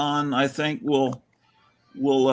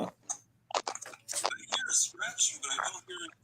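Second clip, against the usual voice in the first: soft whisper, much softer; whispered test; 13 dB quieter than usual. Shown as a demonstration. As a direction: breathe the words as a quiet test. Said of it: "let me see what it's doing".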